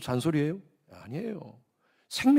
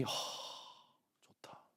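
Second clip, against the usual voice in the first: whispered aside; 21 dB quieter than usual. Pretend. Mock reaction to God's word.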